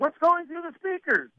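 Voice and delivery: high, mock-exasperated demand; irate boss. Boss complains about song on hallway speakers, high mock outrage.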